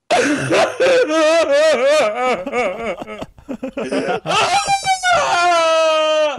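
high, loud crying shout; wordless wail at the sister who slapped him; anguished wail. Retold or imitated. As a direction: scream out your rage and hurt.

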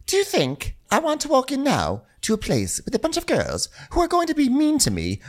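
high mock indignation; imitating Dorit. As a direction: caricature an affronted friend. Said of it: "Dorit's like".

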